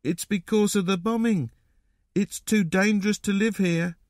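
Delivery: put-on high young boy character; little boy. A narrator's reading. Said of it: "said the little boy".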